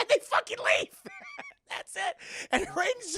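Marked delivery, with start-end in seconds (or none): 1.0-1.6 high, quieter squeal of laughter; helpless high giggle; laughing. Real, not acted. Streamer still cracking up at the plot, squeaky real laugh.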